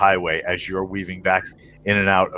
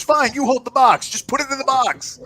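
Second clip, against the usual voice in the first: much higher, fed-up put-on voice; exasperated contact. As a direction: give in with a huff. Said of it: "He goes".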